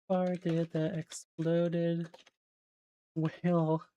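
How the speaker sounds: slow, halting, gaps between words; typing aloud. Real, not acted. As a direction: spell out the question word by word while typing. Sounding out a typed search query.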